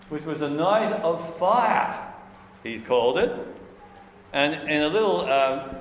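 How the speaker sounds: ordinary talk, nothing marked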